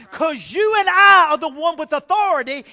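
loud, forceful proclamation; emphatic declaration. Retold or imitated. Wife telling husband they have authority, loud and forceful.

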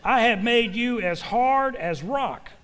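ringing, deliberate Bible recitation; emphatic scripture reading. Real, not acted. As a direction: proclaim the verse, drawing out key words. Reads scripture aloud to congregation.

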